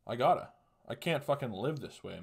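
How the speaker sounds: usual speaking voice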